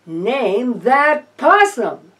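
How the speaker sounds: game show title. Acted.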